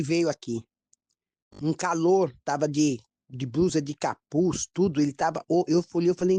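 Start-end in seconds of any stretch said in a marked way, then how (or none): none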